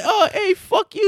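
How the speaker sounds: high, laughing taunt; imitating Shoresy. Shoresy firing back at teammates, high and laughing. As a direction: toss it back with a cheeky laugh. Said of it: "He's like".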